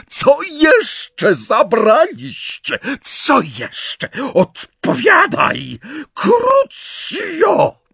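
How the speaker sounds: slow, high screaming interrogation; Bellatrix. A narrator's reading. Bellatrix interrogates Hermione under torture, shrieking in fury. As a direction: scream each word out, drawn out and furious.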